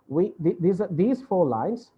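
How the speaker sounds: ordinary talk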